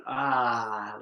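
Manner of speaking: long, open sigh on ah; open voiced ah. Shown as a demonstration. Leader showing how to let it out through the voice, open ah. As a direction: let the sound pour out on ah. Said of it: "letting it come out through the voice".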